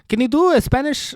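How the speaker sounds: attempted Spanish accent. Host testing himself, attempted Spanish accent. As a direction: try out a shaky-guess Spanish accent. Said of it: "can I do a Spanish accent?".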